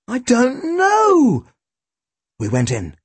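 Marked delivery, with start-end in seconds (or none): 0.1-1.5 high, drawn-out protest; very high, slow boyish answer; baffled boy. A narrator's reading. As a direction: stretch it out slowly at high pitch. Tim answers sceptical inspector.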